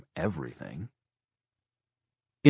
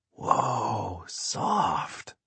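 high, hushed wonder; simple awe; 4 semitones higher, 3 dB quieter than usual. A narrator's reading. Little boy stroking the horse's nose, hushed awe. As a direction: breathe it out like an awestruck small child. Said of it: "speak with such simple awe".

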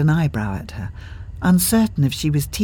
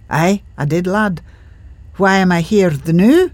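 put-on Scottish accent; 4 semitones higher, 5 dB louder than usual. A narrator's reading. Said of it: "Henry speaks, Scottish ghost".

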